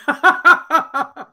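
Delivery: high peal of laughter; real laughter. Real, not acted. Host reads out a smart-arse comment, then laughs high. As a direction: burst into a rapid, high laugh.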